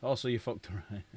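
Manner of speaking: normal talking voice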